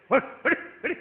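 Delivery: quick rolled shooing calls; short bursts of "hurr"; shooing noise. Retold or imitated. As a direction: imitate shooing a cat away, sharp and high. Imitates shooing the cat off.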